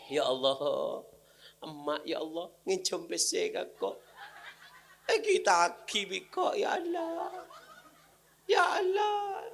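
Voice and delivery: high, hammed-up lament with laughs; mock mourner wailing. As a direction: overact a grieving child.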